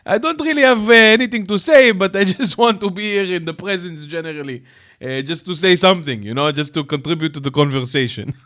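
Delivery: high, loud mocking caller voice; hammed-up impression of a rambling caller; imitating Israeli caller. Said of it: "He's like".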